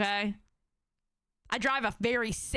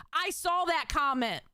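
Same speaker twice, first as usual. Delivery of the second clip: ordinary delivery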